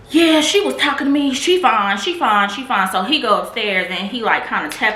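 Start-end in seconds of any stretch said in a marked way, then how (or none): 0.1-2.9 high, loud, brushing it off; dismissive man voice. Retold or imitated. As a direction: wave it away lazily. Imitates the dismissive donor.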